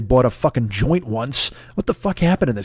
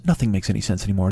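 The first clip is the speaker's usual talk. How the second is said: hushed low intoning of the line; low, quick mock-ominous echo; 7 semitones lower, 3 dB quieter, faster than usual. Shown as a demonstration. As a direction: drop low and fast. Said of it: "Think to yourselves".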